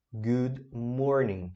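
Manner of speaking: "Good morning" said very slowly; teacher modeling the greeting slowly. Shown as a demonstration. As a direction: stretch out "Good morning" for learners. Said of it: "slow model".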